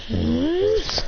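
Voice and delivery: drawn-out inquiring hum; questioning hum. Retold or imitated. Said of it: "He goes".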